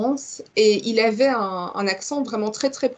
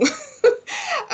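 short, high-pitched giggle; amused laugh. Real, not acted. Podcast guest amused by her own [name] example, high giggle. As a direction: break into a brief high giggle.

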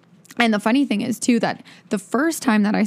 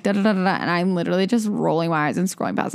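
normal talking voice

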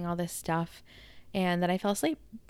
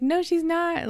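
high, loud insistent denial; delirious denial; 12 semitones higher, 6 dB louder than usual. Retold or imitated. To her mom about Nikki, high loud denial. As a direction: snap back high and loud, sure of it.

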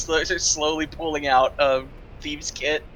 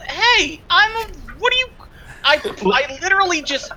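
loud, high exasperated rant; 8 semitones higher, 4 dB louder than usual. Acted. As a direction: vent at the useless party. Frustrated at the locked door.

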